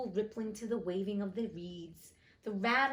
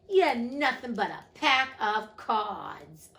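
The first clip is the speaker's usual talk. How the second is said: defiant cry; 2 semitones higher, 8 dB louder, slower than usual. Acted. Alice's final defiance.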